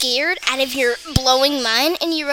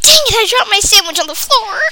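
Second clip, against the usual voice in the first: louder frustrated cry; annoyed outburst; 7 semitones higher, 6 dB louder than usual. Real, not acted. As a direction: blurt it out annoyed. Dropped sandwich outburst.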